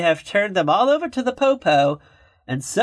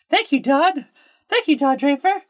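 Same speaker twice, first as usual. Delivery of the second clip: high, eager secretary voice; Peggy Olson; 12 semitones higher than usual. Retold or imitated. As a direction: gush thanks to the boss. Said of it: "she's like".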